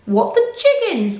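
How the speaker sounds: high shocked bee exclamation; gasped shock. Acted. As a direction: bees gasping in disbelief. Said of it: "gasped all the little bees".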